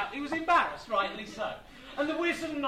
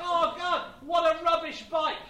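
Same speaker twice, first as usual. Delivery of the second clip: loud, high mocking heckle; jeering shout; 7 semitones higher, 8 dB louder than usual. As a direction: shout scorn from the crowd. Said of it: "shouted Dionysus".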